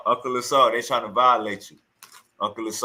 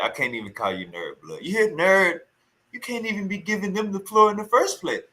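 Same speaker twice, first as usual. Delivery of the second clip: ordinary talk, nothing marked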